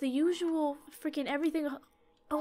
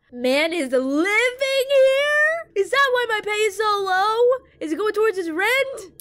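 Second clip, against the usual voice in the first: outraged wailing; 9 semitones higher, 10 dB louder than usual. Real, not acted. Underpaid worker finding a man living there, loud outraged wail. Outraged someone lives in store.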